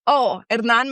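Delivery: usual speaking voice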